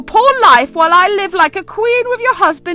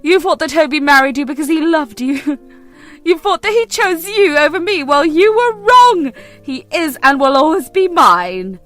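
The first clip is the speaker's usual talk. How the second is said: smug, taunting rival, louder at the end; gloating; 7 dB louder than usual. Acted. Mistress taunts the wife she ruined, gloating. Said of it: "She was the one to gloat".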